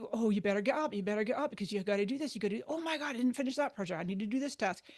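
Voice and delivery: fast, rushed fretting chatter; a restless mind piling on tasks; nagging inner voice. Retold or imitated. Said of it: "the mind starts".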